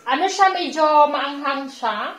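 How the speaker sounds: talking between bites; chewing while speaking; mouth partly full. Real, not acted. Seen 1: Woman puts food in mouth at -2, then talks with the bite.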